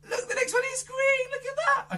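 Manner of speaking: hammed-up wonder; mock amazement. Magician to kids, high amazed reveal.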